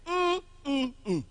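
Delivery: three separate high hums, stepping down; teacher humming a first-stressed word's rhythm; hummed stress pattern. Shown as a demonstration. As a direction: hum high first, then fall. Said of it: "just make the sound".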